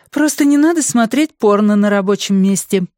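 high-pitched coworker character voice; high, chiding colleague voice; teasing colleague. A narrator's reading. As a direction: tease a startled coworker, pitched well up. Colleague teases startled Mandy.

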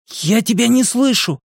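high-pitched shout, little added volume. A narrator's reading. Eddie can't hear Bill over the noise, shouting. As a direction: call out high to be heard. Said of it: "прокричал Эдди".

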